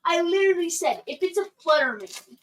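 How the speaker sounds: exasperated. Real, not acted. Annoyed correction.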